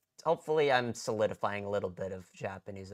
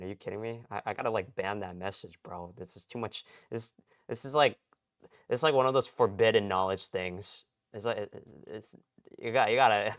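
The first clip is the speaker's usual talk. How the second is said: normal talking voice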